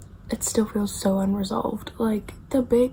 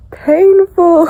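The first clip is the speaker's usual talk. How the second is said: anguished; 7 semitones higher, 12 dB louder than usual. Real, not acted. Upset about character death.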